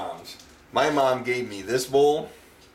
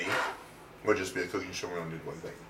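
softer, quicker self-correction; quiet fast aside; 4 semitones lower, 9 dB quieter, much faster than usual. Real, not acted. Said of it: "Well, it would be".

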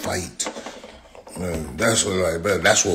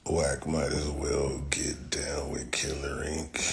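whispered aside; 5 semitones lower, 10 dB quieter than usual. Real, not acted.

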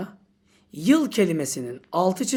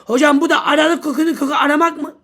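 mock student question; 4 semitones higher, 7 dB louder, faster than usual. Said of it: "adam tuzağı böyle kurdu sana".

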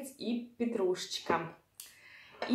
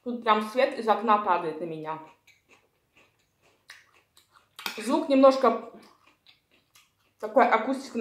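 chewing while talking; talking between bites; mouth full. Real, not acted. Seen 0: Forkful taken at -4, cheeks full and chewing while talking throughout.

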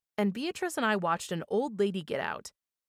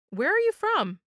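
eager question; 10 semitones higher, 6 dB louder than usual. A narrator's reading. Eager girl asking question.

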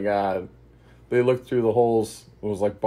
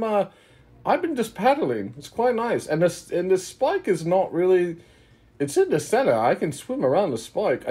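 much higher, playful adventurer chatter; high fantasy character voices; 10 semitones higher than usual. Acted. Trapped party members chat across the flooding room in high voices. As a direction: chirp it up high like a cheerful adventurer.

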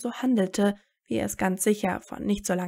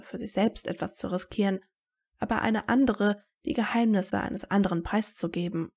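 usual speaking voice

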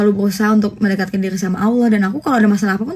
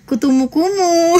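high, drawn-out silly punchline; cheesy punchline; 8 semitones higher, slower than usual. Pretend. Streamer answering her own pickup riddle for viewers, cheesy. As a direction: stretch it out high, cracking yourself up.

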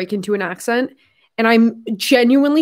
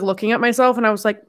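ordinary delivery

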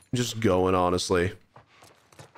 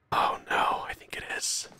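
whisper; 6 dB quieter than usual. Real, not acted. Dreading a boss fight.

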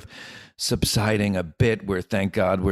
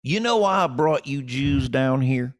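slow Southern drawl, a notch up; Kentucky booker; 5 semitones higher, 3 dB louder than usual. Retold or imitated. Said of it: "and he goes".

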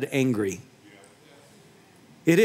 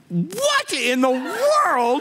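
higher, slow, drawn-out mock astonishment; wife seeing the huge TV box, slow and higher in surprise; amazed wife; 8 semitones higher, much slower than usual. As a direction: stretch it out like she can't believe the size of the box. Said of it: "she did this little heel flip and goes".

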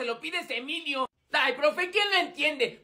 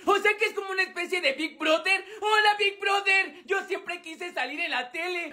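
excited shout; 6 semitones higher than usual. Acted. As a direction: wave at the camera excitedly. Excited about being on TV.

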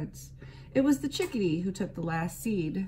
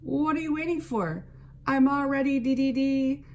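high, loud impatient chickadee; exclaimed; 7 semitones higher, 4 dB louder than usual. A narrator's reading. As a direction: chirp it out, hurrying the others. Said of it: "exclaimed the chickadee-dee-dee".

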